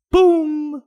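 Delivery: high, loud, held triumphant "Boom". Real, not acted. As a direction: hit it like a big reveal. Rescued by the rover.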